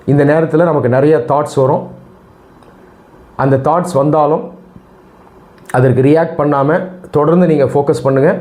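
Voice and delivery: usual speaking voice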